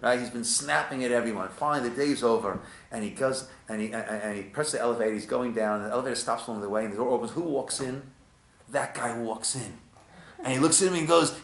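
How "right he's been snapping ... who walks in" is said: ordinary talk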